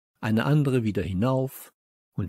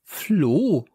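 high, loud call of the name; surprised cry; 5 semitones higher, 5 dB louder than usual. Acted. Mother finding her child at the staff room, surprised cry. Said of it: "rief sie".

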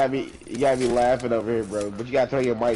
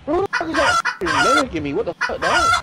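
much higher, slow and louder; high-pitched outburst; 3 dB louder, slower than usual. Real, not acted. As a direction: squeal it out indignantly. Reacting to being mimicked.

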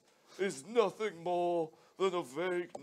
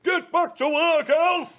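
higher and much louder, barking orders; bossy shout; 7 semitones higher, 13 dB louder than usual. Acted. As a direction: yell at the lazy elf. Santa yells at elf.

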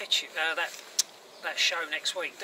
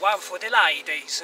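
slow, louder mock Italian charmer; corny Italian accent; 2 semitones higher, 9 dB louder, slower than usual. Friend recalling the sitcom Italian, corny Italian accent. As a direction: ham up a cheesy Italian accent. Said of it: "a right sort of corny Italian accent".

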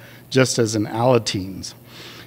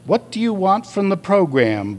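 higher-pitched, mildly booming narrated question; booming group leader; 8 semitones higher than usual. A narrator's reading. Group leader asks what they want from the program, mildly booming. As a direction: put a touch of boom in the group leader's question. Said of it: "boomed the group leader".

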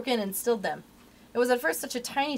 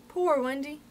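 mild, high moan; 6 semitones higher, slower than usual. A narrator's reading. Nibs imitating the weary bird, mild moan. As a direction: moan it lightly, drawn out and higher. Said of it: "as it flies it moans".

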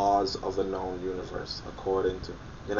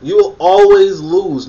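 high, loud and slow; emphatic; 7 semitones higher, 8 dB louder, slower than usual. Real, not acted. Warning the viewers, high, loud and slow. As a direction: stretch it out, warning them. Hammering his counterpoint.

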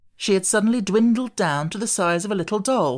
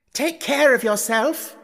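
scream; 7 semitones higher, slower than usual. A narrator's reading.

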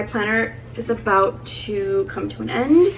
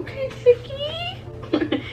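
teasing pet name; 3 dB quieter, much slower than usual. Pretend.

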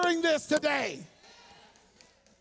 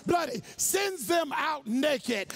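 usual speaking voice

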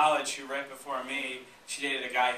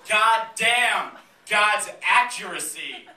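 loud, raised mock amazement; astonished outburst; 6 semitones higher, 9 dB louder, slower than usual. Comic reacting to the interview quote, astonished outburst. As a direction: exclaim it loudly, as if floored.

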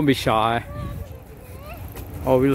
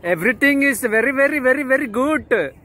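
excited praise; 11 semitones higher than usual. Real, not acted. Praising a stranger's accent.

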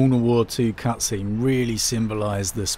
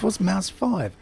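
baffled; 9 semitones higher, slower than usual. Real, not acted. Baffled by the key prompt.

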